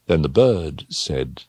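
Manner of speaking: plain reading voice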